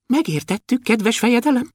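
high, tiny dwarf voice; thin voice. A narrator's reading. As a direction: answer the ruler in a thin pipe. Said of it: "mondta vékonyan Kökölyszi". (vékony, magas törpehang)

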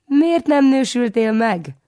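put-on high, slow, sugary old aunt voice; inimitable kindliness. A narrator's reading. Aunt Ilka coos at the nephew, high and slow. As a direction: coo it sweetly like a doting aunt. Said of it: "az ő utánozhatatlan nyájasságával".

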